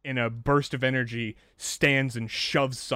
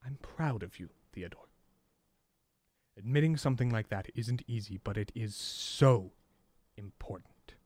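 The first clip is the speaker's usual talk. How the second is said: calm reassurance. Acted. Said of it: "Sun calmly walks back".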